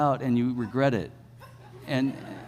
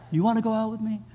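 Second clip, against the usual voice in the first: awkward ask; 8 semitones higher, faster than usual. Retold or imitated. Shy teen asking for date.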